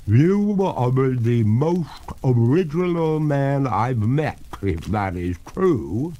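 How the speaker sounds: slow, high-pitched ghost voice; drawn-out, loud ghostly lady; water ghost. Acted.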